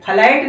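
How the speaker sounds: फ्लैट with a vowel between फ and ल; full first syllable. Shown as a demonstration. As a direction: say फ्लैट as "fa-lait". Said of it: "फ्लैट नहीं". (फ्लैट में फ को पूरा बोलते हुए)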